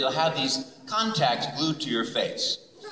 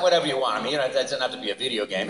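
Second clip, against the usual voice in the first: ordinary talk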